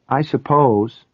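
hesitant, half-convinced agreement; doubtful. A narrator's reading. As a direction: concede it with a touch of doubt. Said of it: "she said doubtfully".

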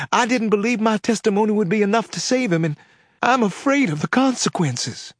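high, strained Southern voice near tears; tearful Southern woman. Acted. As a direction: hold back tears with a Southern accent. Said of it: "she was sobbing".

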